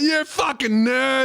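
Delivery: bully sneering at a nerd, overblown; mock bully yell. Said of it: "He goes".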